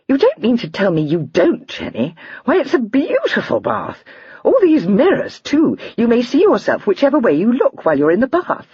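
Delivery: put-on high, light girlish voice, amused; a lively young girl laughing at a joke; young lady Lydia. A narrator's reading. Lydia's voice read aloud.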